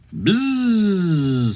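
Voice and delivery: one long, high buzz sliding down; bee buzz. Acted. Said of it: "say the bees".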